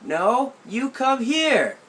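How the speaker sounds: aunt in the garden refusing to come over; aunt calling back. Retold or imitated. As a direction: call it back across the yard, firm.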